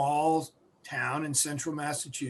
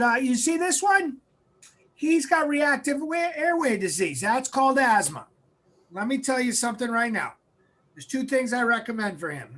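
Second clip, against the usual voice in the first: higher-pitched imitation of a Massachusetts doctor; nasally Massachusetts accent; 5 semitones higher than usual. Doctor giving a mother his diagnosis, nasally Massachusetts accent. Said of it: "he kinda talked in a nasally Massachusetts accent".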